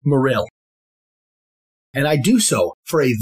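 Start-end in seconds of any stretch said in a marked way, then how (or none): 0.1-0.5 Morrill as "muh-RILL"; stressed second syllable. Shown as a demonstration. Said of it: "I intentionally pronounce it as".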